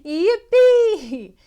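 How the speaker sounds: very loud high cheer; donkey cheer. Acted. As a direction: whoop with joy.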